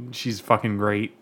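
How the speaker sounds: ordinary delivery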